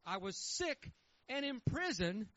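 measured, solemn reading of scripture. Real, not acted. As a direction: read the verses with weight. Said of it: "let me read it to you".

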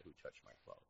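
quiet searching question; hushed Jesus quote. Acted. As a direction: drop the voice for the quote. Quoting Jesus in sermon.

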